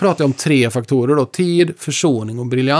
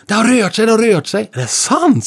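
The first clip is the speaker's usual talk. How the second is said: put-on excited child; 8 semitones higher than usual. Boy tells Hasse the clock moved, excited and high. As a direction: squeal it like a kid discovering the clock moved. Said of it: "sa jag och spelade med".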